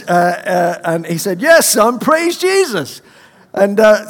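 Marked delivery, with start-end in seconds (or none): 1.4-3.0 put-on high, loud, slow Kansas accent. The Kansas doctor scolding the young student, in accent. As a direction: stretch it out like the Kansas prophet. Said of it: "in a Kansas accent".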